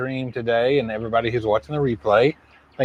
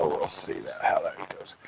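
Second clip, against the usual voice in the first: whispered aside; 5 semitones lower, 12 dB quieter than usual. Real, not acted. Muttered aside to himself.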